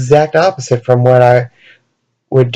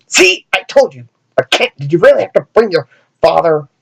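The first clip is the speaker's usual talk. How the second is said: bratty Robin; 3 semitones higher than usual. Pretend. Voicing Damian to Jon Kent.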